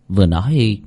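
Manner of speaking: usual speaking voice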